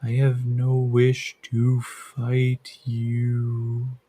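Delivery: slow, soft, gentle voice in his head; soft gentle creature. Acted. The creature speaks into Yakim's head, slow and gentle. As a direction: speak slowly and gently, like a thought. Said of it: "It's very soft and gentle".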